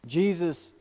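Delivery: ordinary talk, nothing marked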